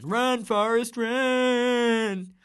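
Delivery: high, loud calling out to Forrest; Forrest Gump shout. Retold or imitated. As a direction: yell it like the movie scene. Mimicking the famous movie shout.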